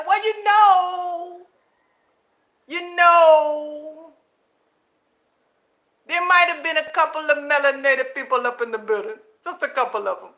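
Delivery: lightly voiced grudging concession; mock backpedaling. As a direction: drag out the reluctant admission. Mocking the channel backpedaling.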